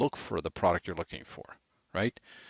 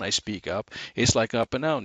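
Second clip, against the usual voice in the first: normal talking voice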